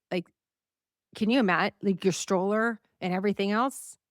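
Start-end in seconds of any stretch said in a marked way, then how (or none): none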